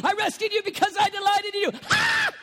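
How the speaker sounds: fast, loud, high; joyful proclamation. Acted. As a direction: proclaim it with glee. Quoting scripture with delight.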